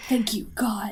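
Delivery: mock grateful whisper. Friend joking about a dad watching sports, whispered.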